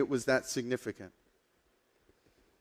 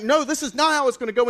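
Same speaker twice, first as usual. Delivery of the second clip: acted protest; 10 semitones higher, 5 dB louder, faster than usual. Peter protests the arrest, acted.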